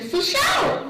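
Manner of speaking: put-on high, squeaky old lady reply. Old lady hustler answering the comedian, high and squeaky. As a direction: chirp it like a little old lady.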